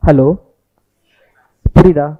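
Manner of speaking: ordinary delivery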